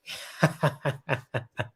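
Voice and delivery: high, light run of laughter; tickled high chuckle; real giggling. Real, not acted. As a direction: giggle on in quick high pulses. Laughing at her own reading.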